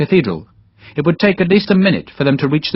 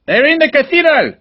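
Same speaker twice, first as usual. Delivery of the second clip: shouted warning; 3 dB louder than usual. Acted. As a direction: call it out high and loud. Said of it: "he shouted at Sharp".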